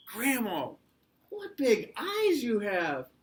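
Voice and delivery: high, loud, stretched exclamation; drawn-out storybook amazement; astonished little girl. A narrator's reading. Girl marvels at Grandma's eyes.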